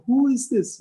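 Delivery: high, loud shocked question; horrified, voice leaping up; appalled disbelief. Retold or imitated. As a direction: exclaim it as if seeing a stranger. King appalled at queen's change.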